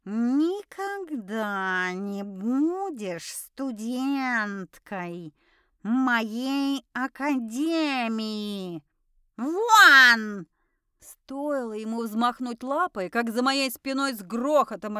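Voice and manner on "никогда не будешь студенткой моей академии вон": very slow cat's tirade, building to a loud yell; furious hissing. A narrator's reading. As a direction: stretch every word, then explode on the last. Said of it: "зашипел предмет нашего обсуждения".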